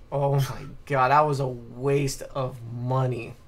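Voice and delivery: ordinary talk, nothing marked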